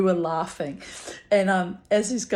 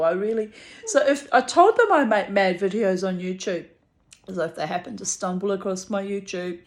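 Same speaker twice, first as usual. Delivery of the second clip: ordinary delivery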